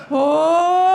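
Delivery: loud, horrified mock moan; haunted moan. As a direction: moan like a Halloween soundtrack ghost. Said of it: "horrible haunted Halloween soundtrack moans".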